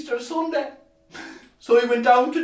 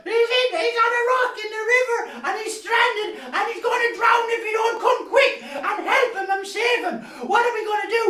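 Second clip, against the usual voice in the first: high, breathless alarm; high, urgent kid calling for help; urgent child; 12 semitones higher than usual. Acted. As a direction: rush it out like a panicking boy. Boy runs for help.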